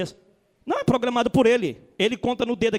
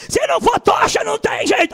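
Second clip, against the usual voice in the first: higher, louder mock yell; mock shout; 9 semitones higher, 8 dB louder than usual. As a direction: bellow the demand like a fiery preacher. Imitated preacher demands fire. (grito exagerado de imitação)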